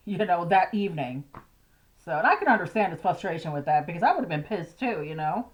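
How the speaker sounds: ordinary talk, nothing marked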